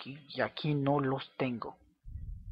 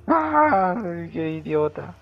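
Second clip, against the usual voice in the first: exasperated outcry; 3 semitones higher, 9 dB louder, much slower than usual. Real, not acted. Gamer scolding themself over the lost block, exasperated outcry. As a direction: cry out loudly in self-reproach, then come down. Blames self after losing block.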